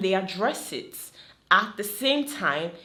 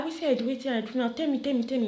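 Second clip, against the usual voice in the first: quick, higher, playful pleading; mock innocent protest; 5 semitones higher, much faster than usual. Friend accused of witchcraft protests fast and playful. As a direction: rattle it off fast like feigning innocence. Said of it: "I should be like".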